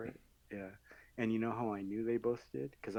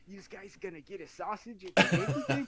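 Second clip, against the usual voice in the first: mock New York guy, a touch higher; New York accent; 7 semitones higher, 4 dB louder than usual. Joking about the fighter's New York accent, lightly mock New York. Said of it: "That's how he sounded".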